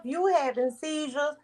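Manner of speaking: ordinary talk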